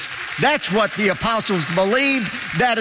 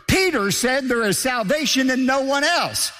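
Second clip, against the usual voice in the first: normal talking voice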